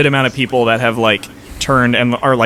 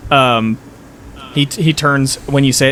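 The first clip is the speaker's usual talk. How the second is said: usual speaking voice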